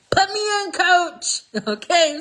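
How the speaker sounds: like a player begging to go in; excited. Real, not acted. Cheering Esther on, high and eager.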